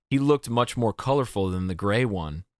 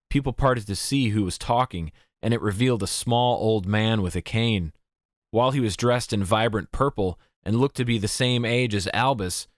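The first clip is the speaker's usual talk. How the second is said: usual speaking voice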